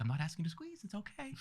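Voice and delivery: high, soft, soothing nurse impression; super calm. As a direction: imitate her trying hard to stay calm. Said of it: "She's trying to be super calm".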